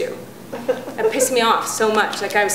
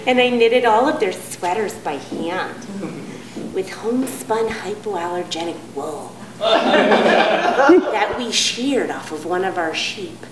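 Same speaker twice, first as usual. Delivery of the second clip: Marin hippie mom; 3 semitones lower, slower than usual. Retold or imitated. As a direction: float it out, smug and unhurried. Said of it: "they all order the same thing".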